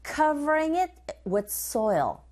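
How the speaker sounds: slow, careful drill reading; teacher modelling the phrase slowly; slow model line. Shown as a demonstration. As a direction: say it slowly for students to copy. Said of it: "고고 리스닝 three".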